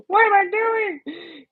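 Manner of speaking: high, slow laughing question; high amused squeak; amused disbelief. Real, not acted. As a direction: squeal it at yourself.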